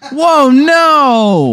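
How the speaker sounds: very high, drawn-out cry of shock; mock horror. Comic told he shook kid to death, high horrified cry. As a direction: wail it in hammed-up disbelief.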